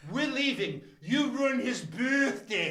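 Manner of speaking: put-on older woman's indignant New England accent; high, soft, slow New England scolding; huffy walkout in a New England accent. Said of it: "With that New England accent".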